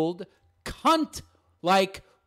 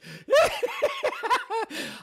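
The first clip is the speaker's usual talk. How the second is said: high cackling laugh. Real, not acted. Laughs at his own mockery.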